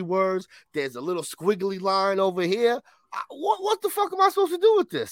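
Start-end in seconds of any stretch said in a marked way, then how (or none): 3.1-5.1 high, strained disbelief; can't believe what you're seeing; exasperated. Real, not acted. Frustrated rant over homework.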